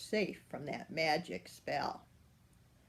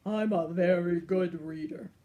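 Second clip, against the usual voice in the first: proud, louder lion boast; imperious lion; 2 semitones lower, 5 dB louder than usual. Acted. The lion boasts to the giant, grand and loud. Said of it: "roared the lion imperiously".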